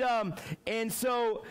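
ordinary delivery

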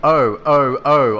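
narrated scream. A narrator's reading. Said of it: "I screamed".

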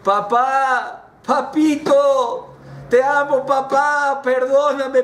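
high, desperate pleading call; acted imploring cry. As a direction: call out to father begging forgiveness, pitched very high. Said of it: "empezó a gritar".

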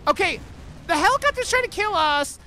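frantic, caught off guard; panicking gamer. Real, not acted. Helicopter attacking, under fire.

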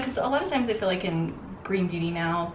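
ordinary delivery

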